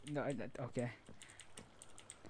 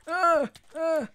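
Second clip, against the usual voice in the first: high giggly taunt; squealing laughter; 13 semitones higher, 10 dB louder than usual. Real, not acted.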